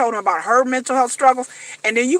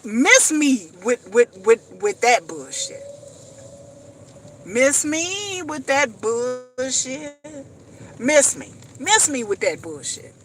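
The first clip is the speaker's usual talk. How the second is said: slow, drawn-out dismissal; miss me with that; 4 dB quieter, slower than usual. Real, not acted. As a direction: drag it out, waving it off. Dismissive catchphrase, slowed and drawn.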